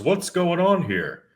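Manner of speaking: a lightly voiced small guy squaring up; a man butting in. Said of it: "He goes".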